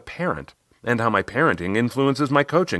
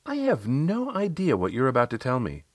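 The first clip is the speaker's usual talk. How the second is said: lightly voiced client, higher, with a little smile; a hint of playful client, pitched up; Riley, slight smile; 6 semitones higher than usual. Client teasing her coach about coming praise, slight smile, higher. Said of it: "She gave a little smile and said".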